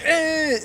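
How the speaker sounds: high, loud engine whine; imitated engine revving. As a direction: whine like a car flooring it. Imitating the accelerating car.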